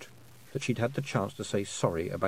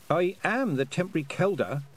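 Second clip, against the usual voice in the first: stiffly formal, pitched up; 8 semitones higher than usual. A narrator's reading. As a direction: assert your title stiffly. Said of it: "said Tiffany stiffly".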